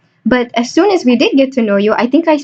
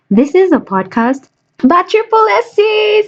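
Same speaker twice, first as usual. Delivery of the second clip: hyped-up podcast intro, pitched well up; announcer hype; 8 semitones higher than usual. Pretend. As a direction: announce the show in a high, put-on voice.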